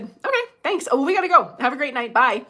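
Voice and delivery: high, bright polite goodbye; polite brush-off. Retold or imitated. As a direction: wrap it up politely and leave. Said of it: "I was polite about it".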